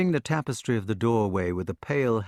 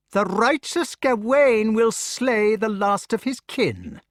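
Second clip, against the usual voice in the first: high, slow, mocking sneer; sick son sneering at Gawain; 11 semitones higher, 5 dB louder, slower than usual. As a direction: jeer at the avenger. Mordred sneers.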